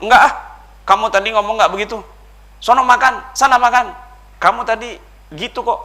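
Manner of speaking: loud, high, accusing; angry husband. Retold or imitated. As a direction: bark at the wife in disbelief. Suami marah-marah pada istri. (suami marah dan menuduh)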